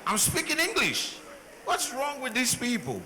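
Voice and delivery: high, frustrated, can't believe they don't understand; exasperated protest. Retold or imitated. Immigrant retelling his frustration at Americans, high exasperated cry. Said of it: "I'm like".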